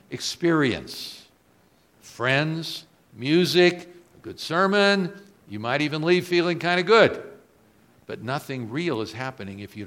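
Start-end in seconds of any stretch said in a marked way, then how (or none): 2.0-7.2 building louder and higher list; rising preaching. Acted. Preacher listing church's comforts, building louder. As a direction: pile it up with rising energy.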